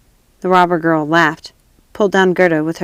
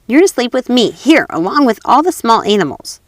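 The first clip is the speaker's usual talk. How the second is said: put-on bold, higher robber girl voice; brash and bossy, a touch louder; 4 semitones higher, 4 dB louder than usual. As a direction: robber girl claiming her guest.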